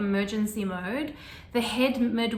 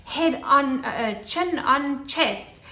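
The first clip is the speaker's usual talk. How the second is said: loud, higher, firm commands; serious orders; 4 semitones higher, 6 dB louder, slower than usual. Retold or imitated. Midwife ordering the mother in labour, loud and military. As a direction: bark the instructions like a drill sergeant. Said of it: "She was, like, really serious".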